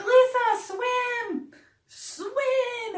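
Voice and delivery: high mock call to swim; imitating friends. Friends on the boat calling to Lisa, high mock urging. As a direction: call her name high and urgent. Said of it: "they're like".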